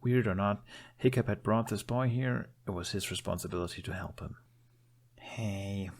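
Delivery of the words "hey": a soft, gentle hey; softly. A narrator's reading. Hiccup calming a frightened boy, said softly. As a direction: ease into it softly, not to startle him. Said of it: "he said softly".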